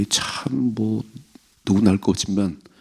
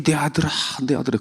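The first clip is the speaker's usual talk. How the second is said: mock wailing lament; 7 semitones higher, faster than usual. Mocking David's lament.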